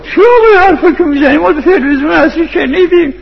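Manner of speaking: very high, straining yell of a heckler; full-voiced, high-pitched imitated outburst; retold shout. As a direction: yell it out in protest, imitating an angry listener. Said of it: "داد زد".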